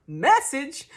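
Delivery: loud, high "Message?"; mock postman. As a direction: pop up and bark "Message?" like a postman. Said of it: "and he's like".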